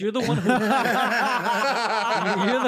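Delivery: words breaking into laughter; laughing. Real, not acted. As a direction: laugh through a teasing roast. Roasting friend, cracking up.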